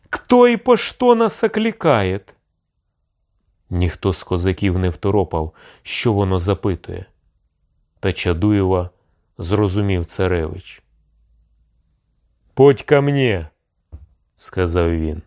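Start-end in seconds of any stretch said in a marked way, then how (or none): 0.1-2.2 very high, level chanting voice; Chaduev. A narrator's reading. Envoy asks through the door crack who calls, high-pitched chant. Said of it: "голосом Чадуєва". 12.5-13.5 high, raised-voice summons; commanding call. A narrator's reading. Tsarevich orders the envoy to come, commanding call.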